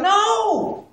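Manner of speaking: loud, high rhetorical exclamation; emphatic no. Shown as a demonstration. Trainer answering his own rhetorical questions, emphatic high no. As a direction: belt out the obvious answer.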